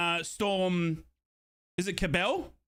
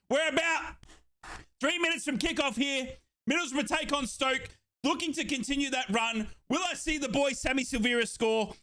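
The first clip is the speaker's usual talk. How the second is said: hype build; 6 semitones higher than usual. Real, not acted. Hyping up before kickoff.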